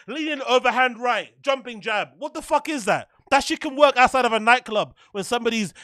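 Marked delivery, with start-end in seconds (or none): none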